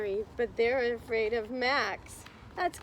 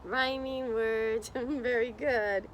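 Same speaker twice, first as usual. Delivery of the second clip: ordinary delivery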